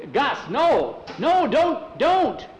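high, loud pleading shout; yelling a warning across the marsh; desperate yell. Acted. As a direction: scream at him to stop. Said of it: "Tom yelled at him".

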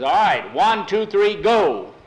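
loud race start call. Acted. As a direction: shout the count to start the race.

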